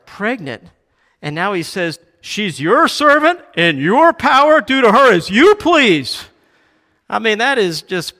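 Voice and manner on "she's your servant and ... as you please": loud, high-pitched acted washing of hands; dismissive handoff. Abraham telling his wife it's her servant, loud and dismissive.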